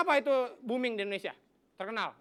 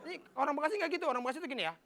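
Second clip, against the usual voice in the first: ordinary talk, nothing marked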